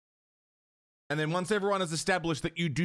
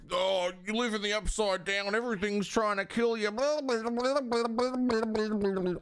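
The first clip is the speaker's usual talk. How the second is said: mocking Americans; 6 semitones higher than usual. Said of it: "whenever Americans are like".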